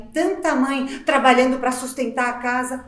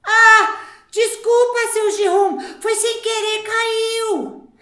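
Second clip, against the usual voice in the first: high, loud sweet mock sorry; fake innocent apology; 6 semitones higher, 6 dB louder, much slower than usual. Mother faking an apology to seu Gerum, high and sugary.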